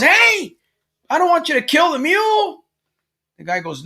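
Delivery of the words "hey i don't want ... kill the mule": very high, louder mock outcry; acted alarmed protest. Farmer objecting as the buyer swings at his mule, high alarmed outcry. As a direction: cry out high to stop someone, play-acted.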